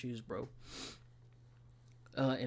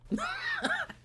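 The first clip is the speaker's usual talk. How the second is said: very high squeaky laugh; a squealing burst of laughter. Real, not acted. Laughing after the move.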